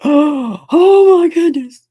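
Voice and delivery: mother retelling her panic at hearing about her son's broken arm; shocked gasp. Retold or imitated. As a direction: cry it out as if you just heard the bone is showing. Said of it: "I kinda lost it".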